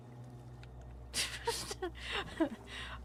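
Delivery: high squeaky breathless giggle; squeaky gleeful gremlin giggle. Real, not acted. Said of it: "Forgive my gremlin noises".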